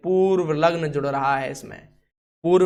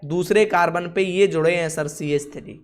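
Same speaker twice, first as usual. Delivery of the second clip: usual speaking voice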